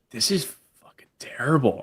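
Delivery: whispered, vague foreign-free aside to wife; hushed aside. Retold or imitated. To his wife at the hotel table, whispered complaint. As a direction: lean in and whisper it so no one hears. Muttering to wife over dinner.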